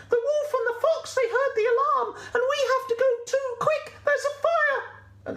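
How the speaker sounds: very high, urgent squealing sheep; panicked sheep. Acted. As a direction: babble the alarm in a high squeak.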